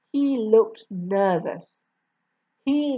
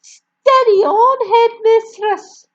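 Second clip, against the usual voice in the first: put-on boy's voice; 13 semitones higher, 9 dB louder than usual. A narrator's reading. Boy answers the raging headmistress.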